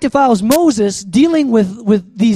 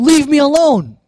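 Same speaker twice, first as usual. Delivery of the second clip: higher, forceful command; imitated angry God; 6 semitones higher, 4 dB louder than usual. God orders Moses away in the preacher's retelling, stern and forceful. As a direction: raise the voice in a stern rebuke. God's rebuke acted once more, raised.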